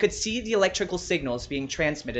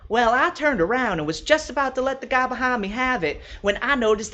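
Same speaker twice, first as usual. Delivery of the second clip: higher, louder chatty woman telling her tale; higher and louder, a lively hostess at her book club; Brenda's voice; 6 semitones higher, 4 dB louder than usual. Acted. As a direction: play Brenda, thrilled to retell how she met her fern. Brenda tells her Home Depot story.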